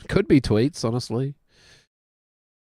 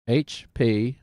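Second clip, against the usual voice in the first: ordinary delivery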